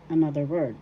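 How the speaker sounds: ordinary delivery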